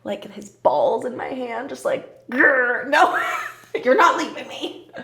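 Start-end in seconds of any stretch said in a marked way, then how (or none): 2.3-4.9 playful growl into high, laughing protest; hammed-up clingy threat through laughter; mock possessive girlfriend.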